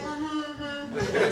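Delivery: high, soft mimicked humming; self-satisfied mother. Mother takes in her friends' praise, quietly self-satisfied. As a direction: hum along softly like a mom accepting praise.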